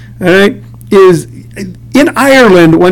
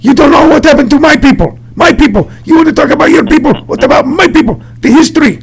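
higher, indignant mother's voice; mock-offended mom lecturing about her people; imitating an angry mom; 8 semitones higher than usual. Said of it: "mom says to me".

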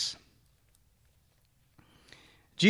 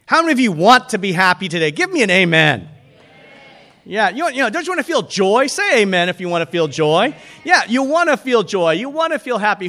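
loud call for an amen; rally the congregation with raised voice; loud, pressing call-and-response preaching; rousing preaching; 3 semitones higher, 7 dB louder than usual. Real, not acted. Preacher rallies congregation for amens.

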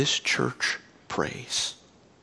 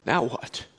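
taunting enemy voice; 5 semitones higher than usual. Retold or imitated. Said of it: "I heard the enemy's voice say".

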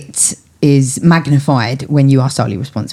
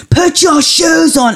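put-on high, slow, drawn-out yell at the child; the third time it finally snaps into a yell; exasperated parent yelling, high and slow; shouting at child; 12 semitones higher, much slower than usual. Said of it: "Oh God, I shouted at him".

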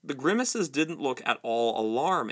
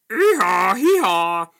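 loud, high braying hee-haw; donkey bray; 6 semitones higher, 10 dB louder, slower than usual. Acted. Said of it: "died away into a donkey's bray".

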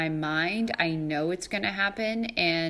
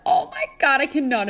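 much higher, louder exclamation; startled; 7 semitones higher, 6 dB louder, faster than usual. Real, not acted. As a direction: blurt it in amused shock. Imagining two puppies.

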